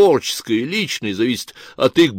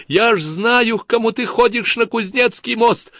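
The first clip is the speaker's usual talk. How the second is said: much higher tearful cry; sobbing accusation; 11 semitones higher than usual. Acted. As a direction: accuse her through sobs. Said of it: "завопила вдруг и зарыдала Тамарка". (с рыданием в голосе)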